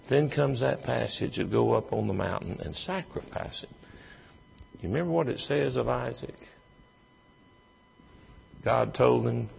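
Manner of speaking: ordinary talk, nothing marked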